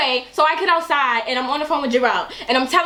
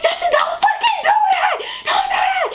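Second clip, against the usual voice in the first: put-on high drunk whine. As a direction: wail it up high like a drunk begging. Quotes her drunk crying self.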